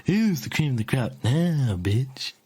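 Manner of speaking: lightly voiced cocky rocker; Fink's voice. Shown as a demonstration. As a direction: taunt it slowly in character. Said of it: "is what Fink would say in his voice".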